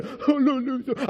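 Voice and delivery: drawn-out pretend wail; demonstrated wail. Shown as a demonstration. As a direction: imitate a noisy howl of crying. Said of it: "make sounds as you're crying".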